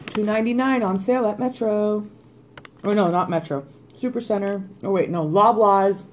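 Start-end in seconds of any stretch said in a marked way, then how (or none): none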